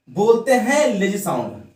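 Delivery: ordinary talk, nothing marked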